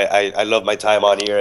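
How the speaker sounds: usual speaking voice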